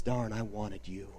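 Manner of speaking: low, quiet acted rage of a thwarted devil; frustrated anger kept very soft and deep; restrained fury. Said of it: "with fury and frustration and rage".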